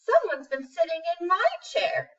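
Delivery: a hint of a fussing mama bear. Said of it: "And Mama Bear says".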